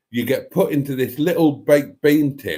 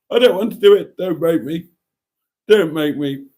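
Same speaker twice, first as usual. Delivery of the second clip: pleading; 5 semitones higher, 5 dB louder than usual. Real, not acted. Pleading not to ride cable cars.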